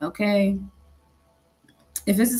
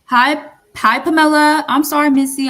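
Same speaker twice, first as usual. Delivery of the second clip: raised, higher voice to a listener; talking loud; 6 semitones higher, 6 dB louder than usual. Real, not acted. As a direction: speak up loudly in reply. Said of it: "I'm, I'm talking loud".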